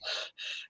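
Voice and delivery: soft, sharp intake of breath; afraid gasp. Acted. Showing how love frightens people, afraid gasp. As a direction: gasp as if love scares you. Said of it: "Like, you're like".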